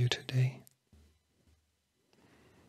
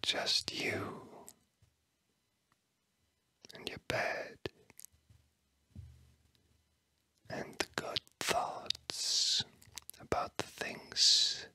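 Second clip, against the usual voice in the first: whispering. Real, not acted. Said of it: "words that I'm whispering to you".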